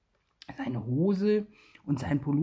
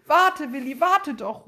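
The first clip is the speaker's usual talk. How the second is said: high, louder call after him; 9 semitones higher, 5 dB louder than usual. A narrator's reading. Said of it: "ruft er".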